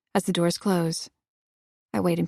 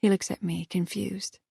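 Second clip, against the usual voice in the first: plain reading voice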